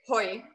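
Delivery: put-on hoi said as a short local greeting, rhyming with boy; dialect greeting. Shown as a demonstration. Showing the chat her region's greeting hoi, slowly. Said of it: "We say".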